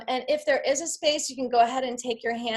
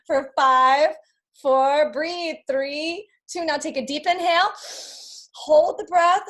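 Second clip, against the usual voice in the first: louder, urgent countdown to the hold; loud coaching count; 4 semitones higher, 5 dB louder, slower than usual. Real, not acted. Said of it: "coach counting down the hold".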